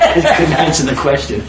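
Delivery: amused, laughing through the punchline. Real, not acted. Joke teller delivering his own punchline, laughing. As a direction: let laughter break in as you finish.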